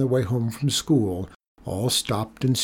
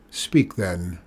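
low, curt permission; understated harshness, pitched low; harsh; 5 semitones lower than usual. A narrator's reading. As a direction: grudgingly let him talk, harshly. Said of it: "said Rico harshly".

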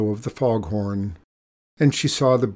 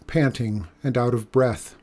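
plain reading voice

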